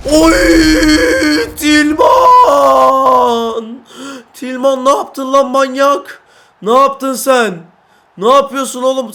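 high, loud thrilled yelling; ecstatic shout. Real, not acted. Gamer screaming at Tilman after his stunning goal. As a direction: scream in disbelief and joy.

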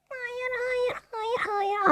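high, soft despairing wail; pained no as the chance slips away; anguished no. Real, not acted. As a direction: whimper it as it goes wide. Watching the shot go wrong.

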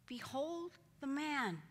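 slow, high, drawn-out plea; pleading Pilate. Acted. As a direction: hold up the broken man, pleading.

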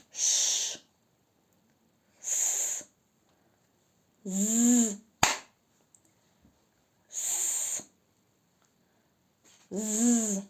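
single consonants for a listening game; isolated sounds. Shown as a demonstration. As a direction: say each sound С, З, Ш alone, with a pause between them. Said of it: "Я буду називати вам звуки".

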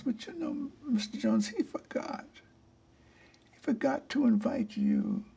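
syrupy, coaxing invitation; saccharine sweet voice. Retold or imitated. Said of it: "dripping sweet with saccharin honey".

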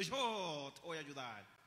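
low, soft, slow rescuer voice; mock God voice. Preacher imitating God coming to help, low and slow. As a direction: play God dropping in, deep and drawn out. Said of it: "espera que salga Dios por ahí diciendo".

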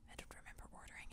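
quick whisper to herself; fast puzzled whisper; whispered aside. Real, not acted. Muttered aside about a package.

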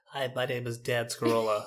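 put-on lightly nasal young nerd voice; a hint of a nerdy rookie introducing himself; nerdy airman voice. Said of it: "young airman voice".

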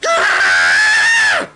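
high, frustrated scream; mad scream. Real, not acted. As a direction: scream out real rage at the game. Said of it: "I'm so mad".

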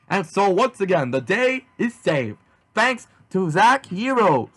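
high, slow, swooping sign-off; heroic announcer. Acted. Narrator closes the victory, announcing grandly. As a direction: proclaim it grandly, stretching the key words.

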